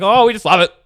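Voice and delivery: high, loud mock enthusiasm; imitated enthusiastic uncle. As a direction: gush loudly like an uncle on TV. Voicing the uncle's reply to TV.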